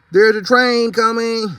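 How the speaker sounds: high, slow alarmed shout; shouted warning. Acted. Driver warns the animals off the bus, shouting. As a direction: yell the warning to everyone. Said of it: "he shouted".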